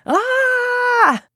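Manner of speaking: big high roaring shout. Shown as a demonstration. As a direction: let it all out in one loud roar. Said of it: "Or roar it".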